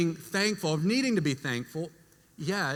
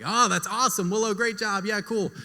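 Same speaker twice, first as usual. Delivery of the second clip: higher, mildly gushing approval; upbeat praise; 5 semitones higher than usual. Retold or imitated. Uncle praising his niece's manners, lightly excited. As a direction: cheer on a little kid.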